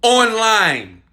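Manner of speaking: the proud moment of a first sale. Retold or imitated. A viewer announcing a first sale online, slow and proud. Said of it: "And they said".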